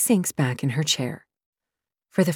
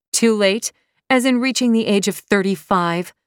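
high, loud scoff; 5 semitones higher, 5 dB louder than usual. Acted. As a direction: scoff at the idea. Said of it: "I scoff".

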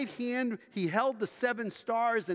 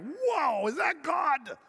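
awed shout; 8 semitones higher, slower than usual. Retold or imitated. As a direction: call out as if you just heard God. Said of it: "he had that kind of booming voice".

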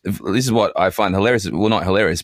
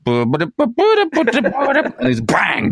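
mock gunshot; 12 semitones higher, much slower than usual. Mimicking gun shots.

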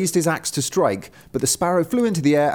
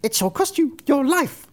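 high bird voice making a threat; crying sparrow; 12 semitones higher than usual. A narrator's reading. Sparrow on the stove threatening wagoner, high cry. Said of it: "and cried".

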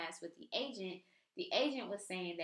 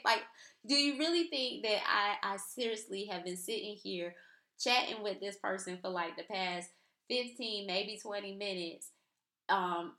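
incredulous sarcasm; 2 semitones higher, 3 dB louder than usual. Retold or imitated. To her husband reaching for the computer, incredulous mocking sarcasm. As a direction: scoff at him as if he could fix it. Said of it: "in my mind, I'm like".

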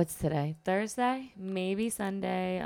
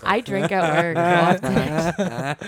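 laughing; 8 dB louder, much slower than usual. Real, not acted. Joking confession.

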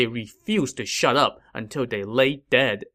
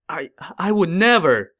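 flustered fear; 6 semitones higher, 3 dB louder than usual. A narrator's reading. Emperor denies in fear.

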